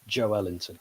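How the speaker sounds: Joelinton with the stress on ELL; middle-syllable stress. Shown as a demonstration. Pundit gives the correct pronunciation, Joelinton stressed in the middle. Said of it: "the stress is on the middle".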